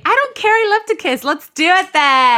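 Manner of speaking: high, giddy retold reply; mock giddy eagerness. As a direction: pitch it way up, stretch the ending. Acts out college kissing dialogue.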